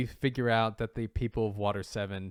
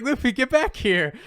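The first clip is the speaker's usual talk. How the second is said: much higher and louder mock-scolding call; playful grandpa shout; 12 semitones higher, 8 dB louder than usual. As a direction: holler after him in fun. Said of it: "He's like".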